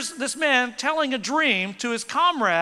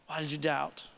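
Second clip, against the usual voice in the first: hushed repeat; 9 semitones lower, 10 dB quieter than usual. Acted. Repeated softer, lower.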